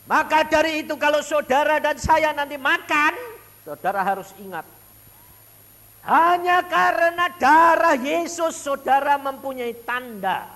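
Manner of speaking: loud, high preaching at full force. Acted. Preacher proclaiming to the congregation, loud high preaching. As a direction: proclaim it to the church.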